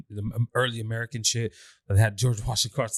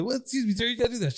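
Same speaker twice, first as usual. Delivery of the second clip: a lightly voiced guard, pitched higher; mock guard, higher and flustered; protesting guard; 11 semitones higher than usual. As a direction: play the flustered guard objecting.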